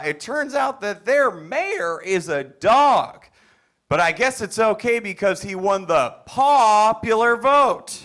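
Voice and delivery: high, loud, over-the-top pandering stand-up; pandering hack comic. Acted. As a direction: play to a room you think is dim, all bright and overeager. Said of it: "get into character by pretending you fine folks are a lot stupider".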